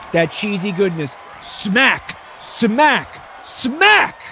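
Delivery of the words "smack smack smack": each SMACK higher, the last a high shout; building blow-by-blow. Pretend. Commentator calling three slaps in a row, building excitement. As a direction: punch out every hit, climbing.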